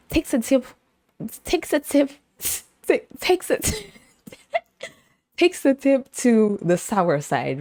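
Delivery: high, laugh-broken repetitions; cracking up mid-phrase; giggly retries. Real, not acted. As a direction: keep retrying the phrase through real giggles. Giggling while repeating the phrase.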